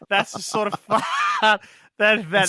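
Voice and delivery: laughing. Real, not acted. Laughing about curry fart.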